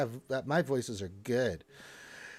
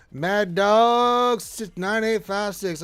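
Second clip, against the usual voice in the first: loud, drawn-out Southern holler; Southern accent; 6 semitones higher, 7 dB louder, much slower than usual. Acted. Host shouts out a viewer's name, Southern accent. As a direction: call the name with a high Southern twang. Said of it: "I use a Southern voice".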